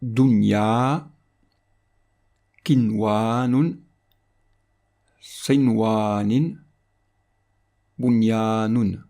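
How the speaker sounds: "Dunya, kinwanun, sinwanin, bunyanun" one by one, each first n brief and clear; no nasal hold on the n, long vowels drawn out slowly; short clear n. Shown as a demonstration. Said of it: "sera prononcé bref et clair".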